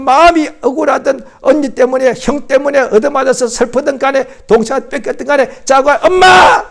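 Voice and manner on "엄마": put-on high, loud wail of a crying child. Preacher voicing a hurt child calling for mom, wailing. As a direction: cry out for mom like a hurt little kid.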